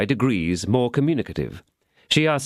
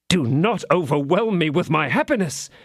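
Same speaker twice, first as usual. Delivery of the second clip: higher, rapturous exclamation; exclaiming; 9 semitones higher than usual. Acted. D'Artagnan throwing himself at her knees, rapturous exclamation. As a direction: gush with mock rapture. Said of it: "exclaimed D'Artagnan".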